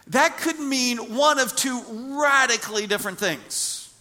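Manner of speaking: loud, high preaching on the Trinity; full-force preaching. Acted. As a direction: preach it out to the congregation. Preaching on Jesus' words.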